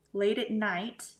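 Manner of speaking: normal talking voice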